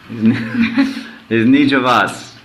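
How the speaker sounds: ordinary delivery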